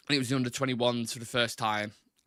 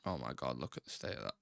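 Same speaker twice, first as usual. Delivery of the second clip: muttering in dismay; 10 semitones lower, 11 dB quieter than usual. Real, not acted. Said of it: "Oh, I'm fuming".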